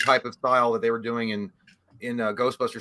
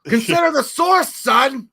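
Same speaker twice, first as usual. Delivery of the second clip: high, slow, shouted actor impression; booming movie father; 11 semitones higher, 9 dB louder, slower than usual. Host answering as the devil father to his son, shouted impression. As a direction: roar it as a hammed-up film patriarch. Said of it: "get into the character".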